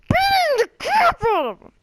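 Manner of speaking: very high, strained mock outburst; exaggerated rage. On the verge of hitting the kid, hammed-up high-pitched rage. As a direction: squeal it through pent-up fury. Hams up urge to beat kid.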